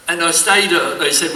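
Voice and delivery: ordinary talk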